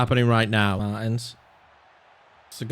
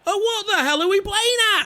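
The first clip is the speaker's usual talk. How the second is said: frustrated outcry. Real, not acted. Frustrated gamer yelling at goal.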